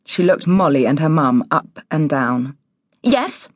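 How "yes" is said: high, curt one-word snap; snappy. A narrator's reading. Woman answering her door to strangers, snappy. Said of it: "she snapped".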